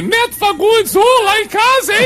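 high falsetto flirty woman voice; man doing a woman's voice. Acted. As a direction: coo it like a put-on lady. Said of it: "Então faz uma voz de mulher". (voz de mulher em falsete, provocante)

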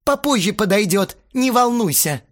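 high, loud irritated retort; snapping back. A narrator's reading. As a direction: snap it defensively. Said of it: "огрызнулся Астарот". (огрызаясь, громко и раздражённо)

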